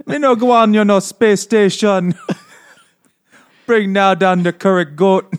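a laughing send-up of a Jamaican voice; mock Jamaican accent. Host imitating the space Jamaican founder's mock Jamaican accent. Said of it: "She starts the space Jamaicans".

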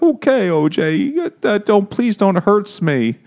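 high, squeaky mock pleading; dopey scared guy. Charlie begging O J not to hurt him, dopey mock fear. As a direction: plead like a terrified dope, hammed-up. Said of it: "dopey guy who's like".

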